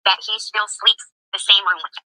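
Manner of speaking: high, sing-song mocking voice of the wife; mocking imitation. Streamer mocking the caller's bragging wife, high and sneering. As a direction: parrot her words back in a high, silly voice.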